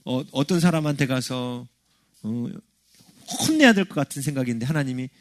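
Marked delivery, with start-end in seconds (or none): none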